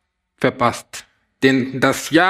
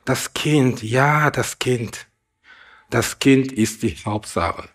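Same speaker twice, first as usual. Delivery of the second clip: softer, lower, slow and abashed; ashamed; 3 semitones lower, 4 dB quieter than usual. Acted. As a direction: mumble it like a scolded ox hanging his head. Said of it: "senkte der Ochse beschämt den Kopf".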